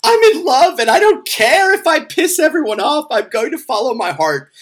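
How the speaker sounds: high, loud, over-the-top lovestruck declaration; mocking lovesick Chris. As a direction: ham up his dramatic speech. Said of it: "she just pantomimes him".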